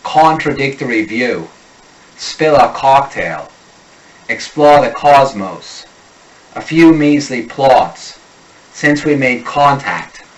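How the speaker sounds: slow Boston accent, short O opened to aw. Shown as a demonstration. Coach drilling practice phrases in a slow Boston accent. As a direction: stretch each O into a Boston aw. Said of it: "The short O becomes awe".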